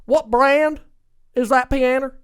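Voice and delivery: mock North Carolina twang, eager question; imitating Southern accent. Southern contestant asking the host about the piano, mock Southern accent. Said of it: "He's like".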